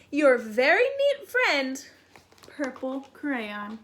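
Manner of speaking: fussy crayon building to a loud, near-tearful outburst; a neat crayon losing patience; exasperated purple crayon. Acted. Reads Purple Crayon's letter.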